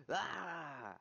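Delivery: drawn-out, high falling "ah"; frustrated yell. Real, not acted. Gamer who lost the kill, frustrated yell. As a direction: let out a long cry of frustration.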